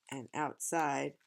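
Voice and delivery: usual speaking voice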